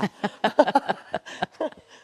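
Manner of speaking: laugh. Real, not acted. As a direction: burst of laughter. Host laughs after reading question.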